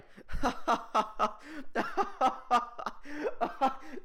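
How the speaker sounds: long high-pitched forced laugh; hammed-up cackle at your own bad joke. Class joker laughing alone at his own joke, forced laughter. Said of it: "but he laughs at 'em anyway".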